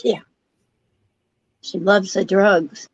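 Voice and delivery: ordinary talk, nothing marked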